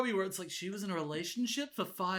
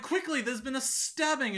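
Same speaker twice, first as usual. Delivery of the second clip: mock urgent alarm; 6 semitones higher, 3 dB louder than usual. Urgent cop quote in recap.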